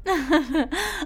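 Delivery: put-on high old-lady chuckle. Mother laughs in high voice.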